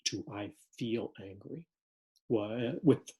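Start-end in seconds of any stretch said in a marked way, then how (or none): 0.3-1.6 "I feel angry" softer and slower, stepped back. Shown as a demonstration. Teacher showing the calmer "I feel angry" softly and slowly. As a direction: ease off and let "I feel angry" settle. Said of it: "notice the difference between four and five".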